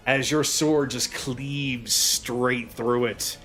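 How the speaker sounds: ordinary talk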